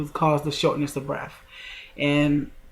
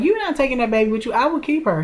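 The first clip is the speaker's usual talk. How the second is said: protective sister; 7 semitones higher, faster than usual. Retold or imitated. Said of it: "And she was like".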